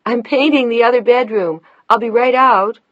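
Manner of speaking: high, loud call to someone far off; calling out from the back. A narrator's reading. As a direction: call it out through the house. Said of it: "she called out to him from the back".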